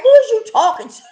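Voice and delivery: high, loud indignant challenge; frustrated. Retold or imitated. Client challenging her counselor at first, frustrated and loud. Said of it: "I was just frustrated".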